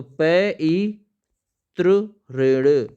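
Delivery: slow letter-by-letter dictation; slow spelling out. Shown as a demonstration. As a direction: spell pitri rin slowly. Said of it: "इस type से लिखा जाएगा". (धीरे-धीरे वर्तनी बताते हुए)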